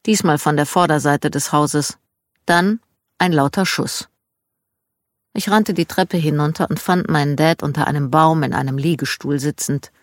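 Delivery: ordinary delivery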